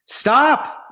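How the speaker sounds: high, loud panicked shout; desperate yell. Acted. Big Anthony yelling at the overflowing pot. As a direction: yell it as the pasta swamps you. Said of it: "yelled Big Anthony".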